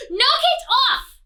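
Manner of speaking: high, loud knight's cry; furious knight halting the crowd; cried aloud. Acted. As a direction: call it out to stop the crowd. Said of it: "he cried aloud".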